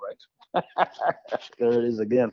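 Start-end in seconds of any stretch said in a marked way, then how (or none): 0.7-1.5 real, high-pitched burst of laughter; rueful laugh. Real, not acted. Musician laughing at his own bad luck, rueful laugh. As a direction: laugh it off rather than cry. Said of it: "It's either laugh at nothing or cry".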